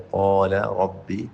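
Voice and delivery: ordinary talk, nothing marked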